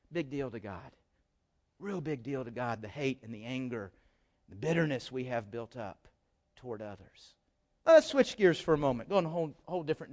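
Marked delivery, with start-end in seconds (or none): 0.1-7.3 hushed emphasis. Real, not acted. Preacher drops for emphasis.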